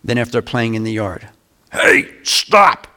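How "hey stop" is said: sudden high, forceful parent's warning shout; loud authoritative shout. Shown as a demonstration. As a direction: shout to stop kids running into the street. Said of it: "it's loud and it's authoritative".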